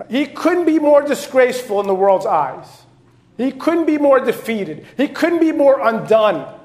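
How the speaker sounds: loud, high, driving preacher's climax; full-force sermon cry; preaching at full force. Acted. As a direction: preach it out, building to the peak.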